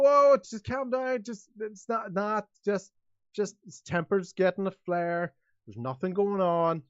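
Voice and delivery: a hint of a fussy official calming people down; nervous mayor. Mayor hushing the town crowd, lightly flustered.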